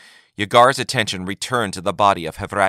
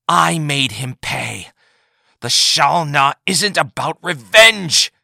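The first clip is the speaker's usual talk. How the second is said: higher, louder, slow and pained; pained Yagar; 7 semitones higher, 6 dB louder, slower than usual. A narrator's reading. Wounded Yagar corrects Taith, pained and slow. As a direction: force the words out through pain. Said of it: "said Yagar painfully".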